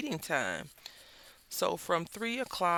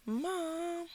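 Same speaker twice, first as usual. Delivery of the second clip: cat voice; 14 semitones higher than usual. Retold or imitated. Cat's look voiced as a word.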